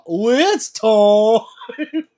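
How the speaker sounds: very slow, high, loud it's time; drawn-out loud mock call of it's time; mock announcement. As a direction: stretch it out like a big jokey call. Running chat joke, performed.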